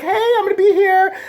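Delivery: high, breathy-sounding put-on girl voice, hammed up; mock teen-girl bait voice, high and whiny; mock innocent girl. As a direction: parody a decoy girl luring him in. Mocking the decoy girl's act.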